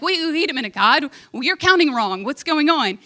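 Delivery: acting out a rattled objection; nervous devil. Devil protesting God's countdown, nervous. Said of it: "the devil gets all nervous".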